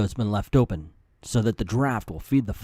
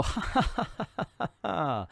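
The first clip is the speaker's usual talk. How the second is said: high mocking villain laugh; hammed-up villain ha-ha-ha; 6 semitones higher, 3 dB quieter than usual. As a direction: cackle like a fleeing crook.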